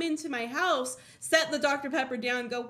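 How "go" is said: ordinary talk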